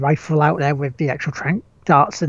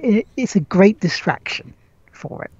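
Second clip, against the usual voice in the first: ordinary talk, nothing marked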